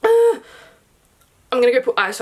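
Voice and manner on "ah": sudden high, loud shriek; startled scream. Real, not acted. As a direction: yelp in fright. Startled by the Antichrist image.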